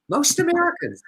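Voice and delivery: mocking disbelief at the claim; incredulous echo. Real, not acted. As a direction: repeat it as if you can't believe it.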